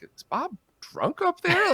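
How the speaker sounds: high-pitched, drawn-out incredulous question; imitated disbelief. Onlookers wondering about the singer on stage, incredulous. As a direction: mimic a baffled onlooker, voice jumping up. Quoting puzzled onlookers at a gig.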